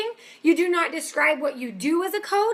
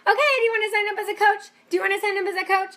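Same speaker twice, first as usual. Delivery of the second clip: pushy broken record; 6 semitones higher, much faster than usual. Retold or imitated. Coach mocks an annoying, pushy recruiting message, fast and insistent. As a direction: rattle it off like a pushy recruiter on repeat. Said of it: "you're not a broken record".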